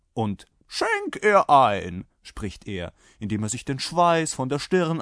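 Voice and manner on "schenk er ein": loud, high, drawn-out order; commanding rider. A narrator's reading. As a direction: demand it slowly at full voice. (lauter, gedehnter Befehl)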